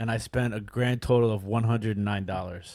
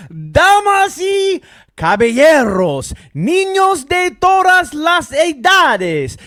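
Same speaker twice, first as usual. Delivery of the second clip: booming slow ring announcer; lucha libre announcer; 10 dB louder, slower than usual. Pretend. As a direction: stretch every word like a wrestling MC. Announcer-style podcast intro in Spanish.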